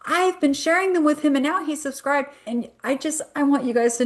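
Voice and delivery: lightly voiced wife chiming in proudly; imitating the wife. Wife overhearing at the closing table, high chirpy imitation. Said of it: "and she goes".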